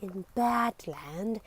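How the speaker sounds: usual speaking voice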